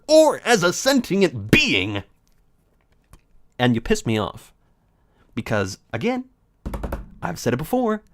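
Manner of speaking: usual speaking voice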